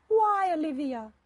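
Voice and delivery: high, loud, drawn-out plea; mother's cry. A narrator's reading. Mother begging Olivia for a reason, crying out. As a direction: wail the question slowly. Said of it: "Mother cried".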